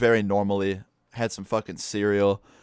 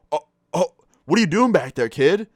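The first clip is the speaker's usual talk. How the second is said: higher, louder startled adult voice; startled driver; 7 semitones higher, 4 dB louder than usual. Retold or imitated. Surprised bus driver to stowaway kid, startled. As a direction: blurt it out, caught off guard.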